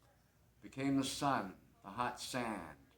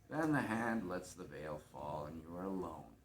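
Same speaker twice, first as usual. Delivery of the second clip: normal talking voice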